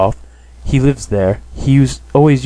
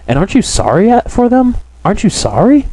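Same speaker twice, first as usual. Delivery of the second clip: high, indignant challenge; flying at him; 11 semitones higher than usual. A narrator's reading. Sonia turns on Raskolnikov, flying at him indignantly. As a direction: demand it with pitch leaping up. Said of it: "Sonia flew at him again".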